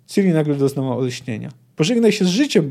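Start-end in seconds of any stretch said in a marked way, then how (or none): 1.8-2.7 raised, higher-pitched threat; mild narrated yell. A narrator's reading. Ciri threatens Bonhart, a mild mock yell. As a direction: lift the voice as if yelling, held back. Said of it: "Bonhart wrzasnęła".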